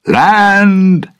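loud, high call from the lookout; sailor shouting up the mast. A narrator's reading. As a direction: shout it out across the deck. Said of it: "A sailor's voice stopped him".

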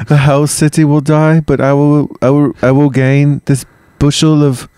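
high, loud mock-greedy woman's voice; playing Tarpeia. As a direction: ham up the treacherous Roman maiden, dragging it out. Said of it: "Wait, I'm a woman, right?".

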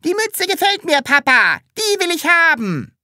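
very high loud dancing cry; excited shout. A narrator's reading. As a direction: shout it while prancing. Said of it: "tanzte herum und schrie".

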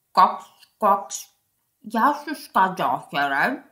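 low storybook frog voice; croaking frog. Acted. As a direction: croak out a greeting as a frog, deep in pitch. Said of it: "είπε ο βάτραχος". (χαμηλή φωνή βατράχου που κοάζει)

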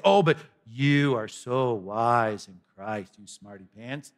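low, slow mock praise; mocking, drawn-out admiration; sarcastic. Mocking the Corinthians as Paul.

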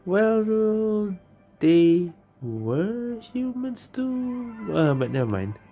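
ordinary talk, nothing marked

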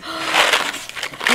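big delighted gasp; excited gasp. Real, not acted. Finding candy in a gift box, excited gasp. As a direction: draw a long gasp of surprise.

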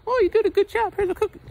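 very high patronizing voice; mock praise. Philosopher rewarding him, very high sarcastic praise. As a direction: pat him on the head like a child. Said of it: "I summed it up like".

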